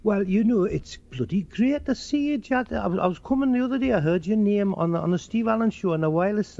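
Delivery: high, lilting Geordie greeting; Geordie accent. Acted. Geordie greeting Joe after hearing him on the radio, Geordie accent. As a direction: talk like a Newcastle local, pitched high. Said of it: "you get the Geordies".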